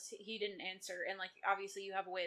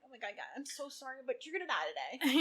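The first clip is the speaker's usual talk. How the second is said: mock blubbering; 4 semitones higher than usual. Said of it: "blubbering every single time".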